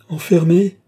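loud, high, outraged cry; shouting. A narrator's reading. Lorenza shouts over Balsamo without listening, crying out. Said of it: "criait Lorenza sans écouter Balsamo".

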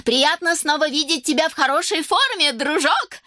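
high, lively friendly greeting; Simonna's high-pitched cheer; delighted exclamation. A narrator's reading. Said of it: "воскликнула Симонна".

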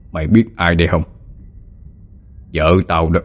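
lightly voiced menacing older man; bitter villain. A narrator's reading. Ông hai shows Hồng Ánh his wife's picture, bitter. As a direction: reveal the grudge with restrained menace.

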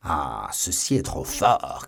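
slow, drawn-out outraged exclamation; affronted cry; indignant cry. A narrator's reading. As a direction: stretch out the outrage, leaning on each word. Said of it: "cria Buckingham".

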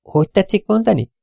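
put-on high, light voice of a young woman on the phone; chirpy office girl, a bit scatterbrained; young receptionist. Young receptionist answering callers on the phone, high and chirpy.

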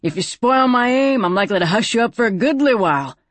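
a mildly threatening gambler; rough gambler threat. A narrator's reading. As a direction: warn her off with a sneer. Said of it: "he said".

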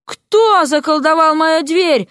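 slow, high indignant outcry; irate owner bellowing slowly; displeased shout. A narrator's reading. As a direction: demand it, stretching the words. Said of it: "недовольный возглас".

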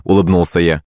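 ordinary delivery, nothing marked

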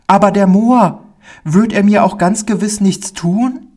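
put-on high, worried child voice; anxious little boy. A narrator's reading. Pauli's fear returns before the man, anxious high child voice. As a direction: plead for reassurance as a frightened small boy. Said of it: "fiel ihm wieder seine Angst ein".

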